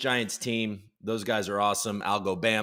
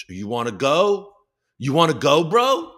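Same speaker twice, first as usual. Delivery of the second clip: mock challenge; 3 semitones higher, 6 dB louder than usual. Senator challenging the Teamsters president, mock challenge. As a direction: square up with an escalating, pitched-up question. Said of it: "stand up and be like".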